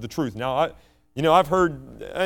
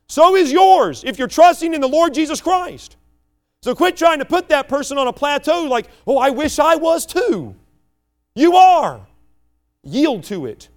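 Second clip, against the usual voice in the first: much higher, loud preacher's cry; fervent preaching; 9 semitones higher, 5 dB louder than usual. Real, not acted. Preaching to the congregation that they are anointed, loud and fervent. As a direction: lift it up and proclaim it.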